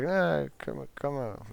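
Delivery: lightly voiced eager insistence; coaxing. Sonny cheerfully urges C to come hang out.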